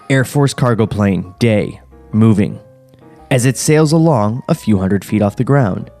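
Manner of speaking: ordinary delivery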